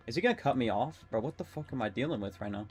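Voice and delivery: ordinary talk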